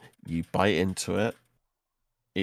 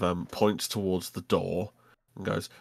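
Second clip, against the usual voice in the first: usual speaking voice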